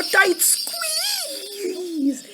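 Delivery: high, stretched-out squeeze; acted-out squeeze. A narrator's reading. Storyteller has children hug themselves, high drawn-out squeeze. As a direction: squeeze the word out long and high.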